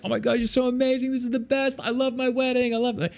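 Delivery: high gushing fan voice; mock adoration. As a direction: gush like a starstruck bride. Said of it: "be like".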